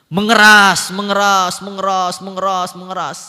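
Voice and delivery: "Mengeras" hit loud and high, repeats easing off; insistent repetition. Real, not acted. Preacher warns of hardening hearts, "Mengeras" repeated insistently.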